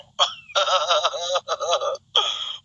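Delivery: rattling laugh breaking upward; long cackle. Real, not acted. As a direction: cackle in bursts, ending on a squeal. Laughs after plea to supporter. (قهقهة متقطعة طويلة)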